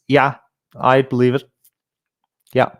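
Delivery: ordinary delivery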